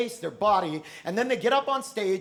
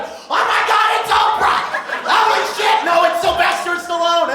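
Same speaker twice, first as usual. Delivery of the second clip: much higher, louder excited shrieks; screaming crowd; 8 semitones higher, 7 dB louder than usual. Acted. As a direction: shriek like a hyped audience. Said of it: "the crowd's like".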